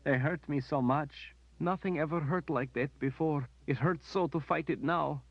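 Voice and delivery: high, tearful lament; high weeping lament. A narrator's reading. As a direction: pour out the hurt in tears. Berdine weeping over her pain.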